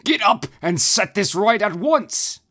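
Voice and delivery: high, loud raging command; the Hierophant raging over his ruined temple; furious shriek. Acted. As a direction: shriek the orders in fury. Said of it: "shrieked the Hierophant in rage".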